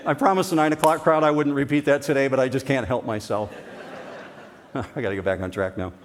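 chuckling through the words; real laughter while speaking; laughing. Real, not acted. Laughing after Ohio State joke.

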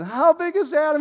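put-on very high, loud baby-talk question; parent's playful question. Father repeating the game with his toddler, excited baby talk. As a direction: pitch it way up for a toddler. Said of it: "and we'd do it again".

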